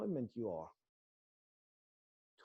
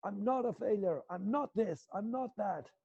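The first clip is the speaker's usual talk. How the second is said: higher, defiant shout back at dad; defiant yell at dad; 6 semitones higher than usual. Shown as a demonstration. Defiant son yelling at dad about his life, a pitched-up shout. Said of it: "you're screaming at Dad".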